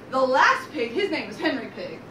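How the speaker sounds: ordinary delivery, nothing marked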